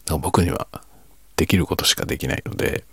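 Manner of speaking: ordinary delivery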